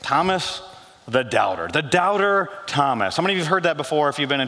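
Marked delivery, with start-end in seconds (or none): none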